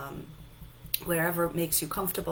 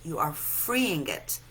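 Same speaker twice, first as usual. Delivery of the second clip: ordinary talk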